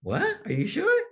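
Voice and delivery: high, disbelieving squeak; can't believe the news; incredulous friend. Retold or imitated. Friend hears lottery win, sounds stunned and disbelieving. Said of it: "Jason's gonna be like".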